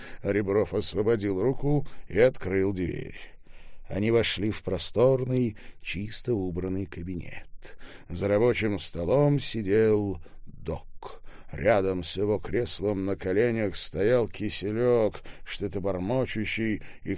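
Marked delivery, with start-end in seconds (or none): none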